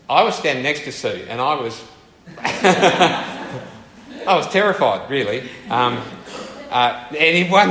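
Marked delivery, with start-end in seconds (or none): 2.1-5.1 higher pitch through a laugh; real laughing. Real, not acted. Speaker recalling standing next to Sue, laughing. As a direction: laugh as you admit it. Laughs telling own fright.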